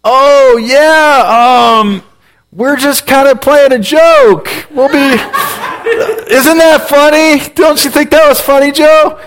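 high, slow, laughing fake cheer; nervous laughing cover-up. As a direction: laugh it off to hide a mistake. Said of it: "I'm like".

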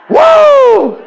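loud high-pitched "woo"; excited whoop. Real, not acted. Preacher thrilled by his own story, big whoop. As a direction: let out a long piercing whoop.

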